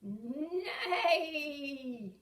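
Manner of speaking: long mock whinny; playful drawn-out neigh; horse neigh. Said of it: "makes the neigh sound".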